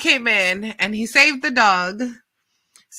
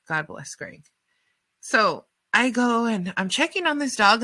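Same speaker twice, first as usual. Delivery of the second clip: normal talking voice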